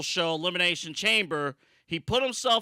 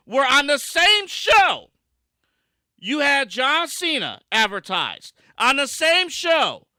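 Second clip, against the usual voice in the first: loud, raised ranting; angry rant; 4 semitones higher, 6 dB louder than usual. Real, not acted. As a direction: vent the outrage. Heated rant about The Rock.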